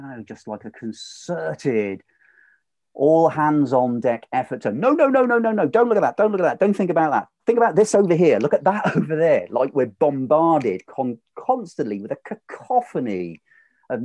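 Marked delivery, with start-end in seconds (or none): 4.7-9.5 high, loud, hammed-up frantic telling-off; a loud mock rant, pitched way up; mock news barrage. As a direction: bark orders like a pushy newsreader. Mocking the news media's voice.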